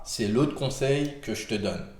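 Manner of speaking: normal talking voice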